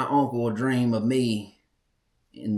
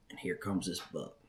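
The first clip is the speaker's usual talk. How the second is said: softer hunter's hush; 5 semitones lower, 13 dB quieter than usual. Retold or imitated. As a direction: keep it low like the buck might hear. Buck appears, hushed first take.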